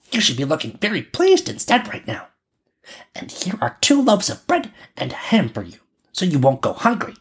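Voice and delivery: put-on high child's voice, scolding then providing; bossy robber girl. A narrator's reading. Robber girl hands Gerda food, bossy. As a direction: play the little bandit girl up high. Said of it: "the little robber girl said".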